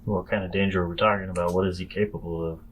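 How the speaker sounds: ordinary talk, nothing marked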